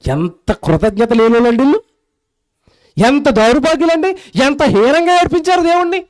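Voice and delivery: ordinary delivery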